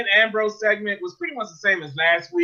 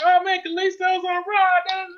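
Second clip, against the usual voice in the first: high-pitched mock excitement; mock hype; 9 semitones higher, faster than usual. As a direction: parody the big-deal hype, pitched way up. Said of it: "they made a big deal".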